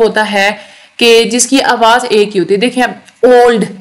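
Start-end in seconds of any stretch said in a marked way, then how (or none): 3.3-3.7 "old" as one firm, isolated beat; one syllable. Shown as a demonstration. Teacher showing a one-syllable word, "old" in one beat. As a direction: say "old" louder, in a single sound. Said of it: "एक ही आवाज़ थी ना".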